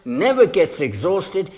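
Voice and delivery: ordinary delivery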